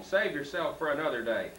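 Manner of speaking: usual speaking voice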